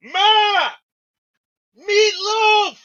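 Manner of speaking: high, loud yell for Ma and the meatloaf; shouting from the far end of the room after putting the mic down. Pretend. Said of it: "I don't know if I can do a good impression of that".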